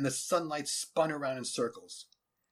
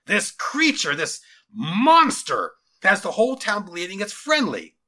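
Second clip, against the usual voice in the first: loud, high, mounting tirade; vehement general; 7 semitones higher, 10 dB louder than usual. Acted. General warning his lieutenant about the monster, vehement. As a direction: stretch the key words and hammer each warning.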